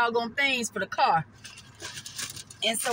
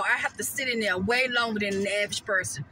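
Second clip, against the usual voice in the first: ordinary talk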